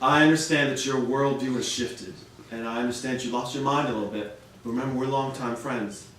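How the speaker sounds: speaking softly to a friend who bit you; tender forgiveness. Retold or imitated. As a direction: forgive an old friend gently. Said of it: "So I said".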